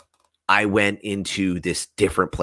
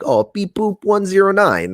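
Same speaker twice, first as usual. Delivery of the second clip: much higher, louder mock robot beeping; 13 semitones higher, 5 dB louder than usual. Talking to the robot entity in a mock robot voice. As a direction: play a squeaky toy robot. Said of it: "one kind of look like a robot".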